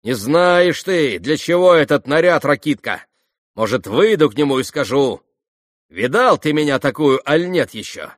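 bitter, defiant challenge; spiteful taunt. A narrator's reading. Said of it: "Закончила Грушенька со злобным смешком".

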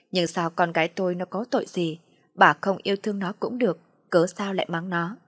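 ordinary delivery

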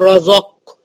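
"رزق" as dark "ro-zoq" with stopped qaf; wrong reading. Shown as a demonstration. Teacher shows what to avoid, "رزق" all heavy. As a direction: make the zay heavy in "رزق". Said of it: "مش هقول".

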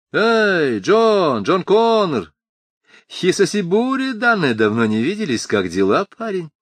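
high, loud friendly hail; effusive greeting. Acted. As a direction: greet an old friend loudly. Eddie greets loudly.

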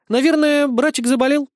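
high, sugary innocent tone. Acted. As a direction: play the innocent, sweetly. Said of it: "невинным тоном заявила Альканиэль".

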